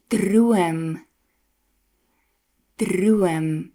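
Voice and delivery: trilled R in Praat, Brood, Droom, Werk, Kers; rolled R inside words. Shown as a demonstration. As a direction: roll the R clearly inside each word. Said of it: "the R when it's used in a word".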